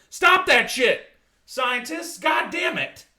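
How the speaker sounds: hammed-up furious shout; mock angry yell. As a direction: yell at the scientists in mock outrage. Yelling at scientists.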